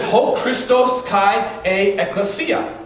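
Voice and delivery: slow, careful Greek reading; reading Greek aloud. Shown as a demonstration. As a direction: read the Greek phrase slowly and deliberately, syllable by syllable. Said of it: "Who can read the Greek?".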